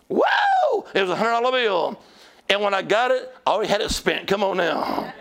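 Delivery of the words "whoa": very high, falling "whoa"; re-enacted delighted whoop. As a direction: whoop at the sight of the banknote. Re-enacts joy at the money.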